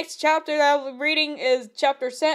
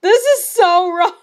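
laughing through the words, louder; cracking up at the story; 6 semitones higher, 6 dB louder than usual. Real, not acted. Reader cracking up at the fanfic, laughing. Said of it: "I'm screaming".

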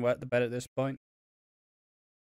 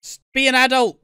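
higher and louder exasperated telling-off; mock angry rant; 7 semitones higher, 6 dB louder than usual. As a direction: scold him to grow up. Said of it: "I'm like".

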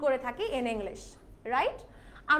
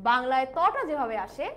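normal talking voice

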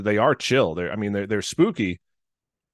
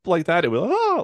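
voice cracking; 10 semitones higher, 4 dB louder than usual. Retold or imitated. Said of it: "my voice was just very squeaky".

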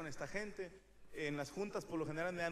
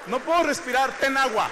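shouted, much louder and higher; exasperated shouting retelling; mock outrage; 7 semitones higher, 16 dB louder than usual. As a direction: yell the absurd exchange in disbelief. Mocking absurd airline answer.

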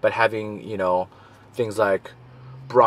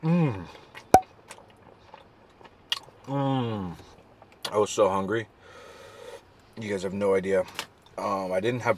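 talking between bites of burrito; chewing while talking; 5 dB quieter than usual. Real, not acted. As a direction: mouth partly full of food. Seen 1: Holds burrito, recent bites, lips moving as if chewing while talking.

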